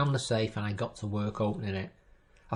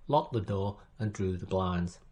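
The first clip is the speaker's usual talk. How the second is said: plain reading voice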